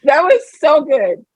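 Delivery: high giggling praise; delighted giggles. Real, not acted. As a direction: laugh through it.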